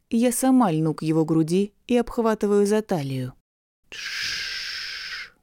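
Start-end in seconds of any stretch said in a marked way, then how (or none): 3.9-5.3 whispered gentle hush; soft shush. Acted. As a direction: hush her softly in a whisper. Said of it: "тихо шепчет он".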